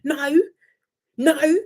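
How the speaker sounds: high-pitched imitation of indignant protest; Casey. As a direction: act out standing up for oneself, pitched high. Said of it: "Casey was like".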